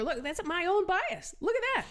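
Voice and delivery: self-caught surprise. Retold or imitated. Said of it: "I said to my neighbor".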